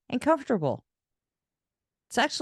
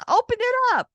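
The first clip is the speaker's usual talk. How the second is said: far higher, louder eager urging; dad thrilled about his gift, much higher; excited dad; 5 dB louder than usual. Retold or imitated. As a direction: urge her to open the gift, thrilled. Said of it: "He was so excited".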